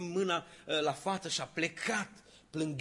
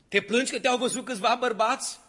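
higher and much louder, swelling to a ringing declaration; rising proclamation; 10 semitones higher, 12 dB louder than usual. Acted. The Holy Spirit answering Fatima, swelling loud proclamation. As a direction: build it up like a thunderous rebuke.

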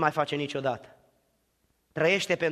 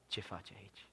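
much softer, lower, hushed question; soft voice of God; 7 semitones lower, 18 dB quieter than usual. Acted. God to Elijah in the cave, hushed. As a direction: ask gently, almost a whisper.

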